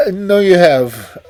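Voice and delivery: gasping, out of breath; breathless gasp. A narrator's reading. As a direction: blurt it out, winded and scared. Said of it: "a visitor gasped".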